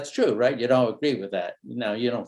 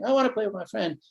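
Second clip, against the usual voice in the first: put-on a child repeating his plea, much higher; whiny kid; 8 semitones higher, faster than usual. Son pleading again with mom, higher. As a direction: mimic the boy insisting again.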